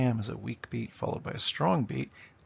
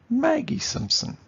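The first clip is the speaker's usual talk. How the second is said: MAG-gie SIMP-son, "Mag" pitched high; strong-weak, strong-weak on "Maggie Simpson"; two trochees; 4 dB louder than usual. Shown as a demonstration. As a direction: lift the first syllable of "Maggie" high. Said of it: "Two trochees".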